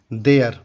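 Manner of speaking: देयर said as "dare", one clear falling word; model pronunciation. Shown as a demonstration. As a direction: give देयर as the teacher's model, falling at the end. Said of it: "इसको हम पढ़ेंगे देयर".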